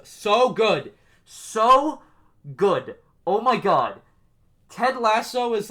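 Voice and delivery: so good, blurted high and loud; can't contain the excitement; rising gush of praise; excited outburst. Real, not acted. Gushing over Ted Lasso.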